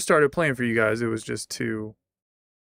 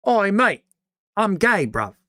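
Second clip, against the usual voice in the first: much higher, louder hammed-up British call; mock British shout; 8 semitones higher, 6 dB louder than usual. Streamer hamming up a British voice for chat. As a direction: bellow it like a cartoon Brit.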